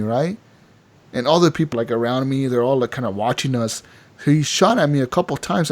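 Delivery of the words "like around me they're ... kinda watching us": normal talking voice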